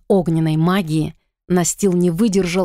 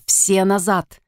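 mildly raised commanding call; shouted order; slower than usual. A narrator's reading. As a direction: order everyone back, restrained. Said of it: "крикнул Итан". (сдержанный приказной окрик)

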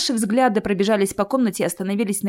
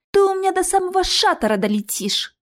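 high, indignant threat; threatening; 10 semitones higher than usual. A narrator's reading. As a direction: threaten him in a high girl's voice. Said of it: "пригрозила Алиса". (угрожающе, высоким голосом)

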